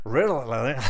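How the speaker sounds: Ritalin in a laughing, half-failed American accent; attempted American accent. Shown as a demonstration. To viewers, a botched American accent on Ritalin, laughing. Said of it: "I can say it better with an American accent".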